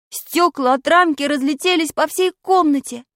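plain reading voice

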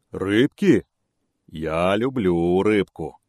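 loud, slow, greedy bear. Acted. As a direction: savour it like a hungry bear. Bear wants fish.

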